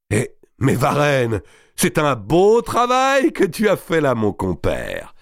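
high, delighted cry easing down at the end; dazzled exclamation. A narrator's reading. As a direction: hail your comrade's work with amazement. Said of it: "s'écria Robert ébloui". (exclamation éblouie et admirative)

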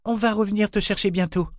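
high, forced cheer; falsely cheerful. Acted. Mother promises her boy she will return, falsely cheerful. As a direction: reassure with put-on gaiety. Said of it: "d'un ton faussement joyeux".

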